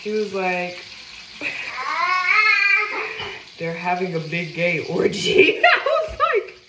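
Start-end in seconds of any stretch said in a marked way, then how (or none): 0.8-3.5 high, rising laugh; cracking up. Real, not acted. Storyteller breaks up before quoting the man, high laugh. 3.6-5.7 slow quote breaking into laughter; laughing. Real, not acted. Retelling the man's club warning, laughing. Laughs through the retold line. 5.7-6.6 very high, laughing words; laughing hard. Real, not acted. Storyteller cracking up before giving own reply, laughing.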